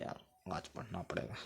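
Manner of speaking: unvoiced, mopey whisper of a child who must study; sad child whisper. Teacher voices a glum student dreading Monday, whispering. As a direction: whisper it like a kid dreading school.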